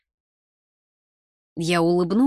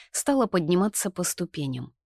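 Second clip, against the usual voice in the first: ordinary delivery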